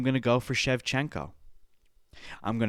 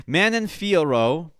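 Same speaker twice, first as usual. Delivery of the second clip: emphatic name call; 6 semitones higher, 7 dB louder, slower than usual. Real, not acted. Announcing name after learning it.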